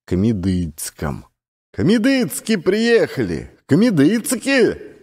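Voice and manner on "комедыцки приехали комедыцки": high excited shouting out news. A narrator's reading. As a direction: shout it out to everyone, thrilled. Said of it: "кричал Ермошка".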